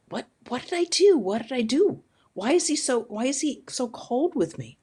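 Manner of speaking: high, hurt voice pleading. Retold or imitated. Disciple begging the attendant to explain, hurt and high.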